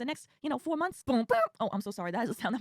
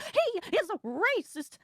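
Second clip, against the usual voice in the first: higher, very slow and far-off; mock woman voice; 7 semitones higher, much slower than usual. Rider accusing MAGA man, slow high mock voice. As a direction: drag out each word like an outraged rider. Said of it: "she was like".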